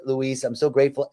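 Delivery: normal talking voice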